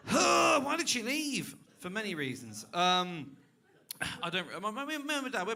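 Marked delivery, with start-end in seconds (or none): 0.1-1.5 high, slow, wailed mock lament; a hammed-up sob of a question; mock despair. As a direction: wail it in put-on self-pity. Mock despair after a sigh.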